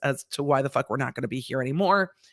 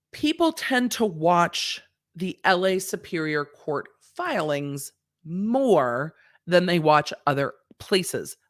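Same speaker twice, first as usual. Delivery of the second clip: ordinary delivery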